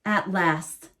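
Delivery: ordinary delivery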